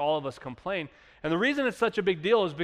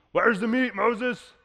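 higher, mock-petulant demand; whiny demand; 5 semitones higher, slower than usual. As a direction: whine at Moses for meat. Israelites demand meat from Moses.

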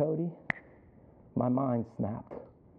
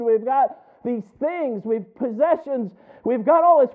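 rising louder and higher with each item in the list; building preacher's cry; 7 semitones higher, 6 dB louder than usual. Real, not acted. Pastor recalling empty possessions, building from firm to a near-shout. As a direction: pile up the list, pushing harder each time.

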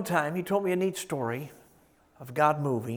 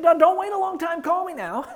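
imitating a friend; 12 semitones higher than usual. Retold pastor insisting, laughing.

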